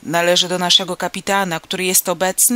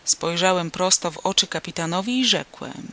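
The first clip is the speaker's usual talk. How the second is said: usual speaking voice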